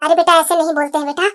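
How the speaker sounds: soft, low motherly reproof; gentle chiding. Acted. Mother correcting her son, gently chiding. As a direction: hush him gently, voice dropped low.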